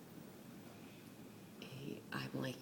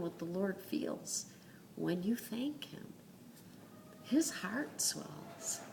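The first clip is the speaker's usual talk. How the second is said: tender whisper; 3 semitones higher, 7 dB quieter, slower than usual. Real, not acted. Tender hushed aside in sermon.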